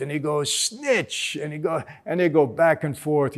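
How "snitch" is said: mock insult. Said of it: "yelling at each other in a joking way".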